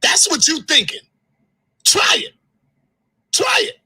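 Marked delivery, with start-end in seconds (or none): 1.8-3.8 high-pitched defiant dare; daring challenge. Real, not acted. Daring Polite to come at her, a high defiant challenge. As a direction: throw it at him high: go on, try me.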